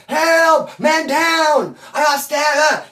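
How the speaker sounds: mock yell for help. Inmate yelling for the cops.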